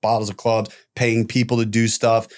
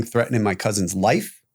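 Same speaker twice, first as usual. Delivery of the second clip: ordinary talk, nothing marked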